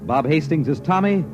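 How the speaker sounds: usual speaking voice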